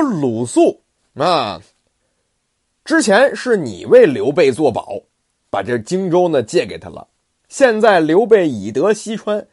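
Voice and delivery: 更响，质问鲁肃的怒气; 拉长"鲁肃啊"再步步逼问; 孙权大怒. Acted. 孙权大怒责备鲁肃. (君主责备臣子，声音洪亮带怒)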